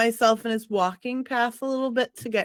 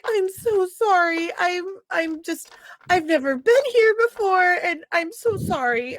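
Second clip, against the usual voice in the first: put-on weepy innocent girl voice; fake teary apology; 6 semitones higher than usual. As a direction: pour on the crocodile tears. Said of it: "and be like".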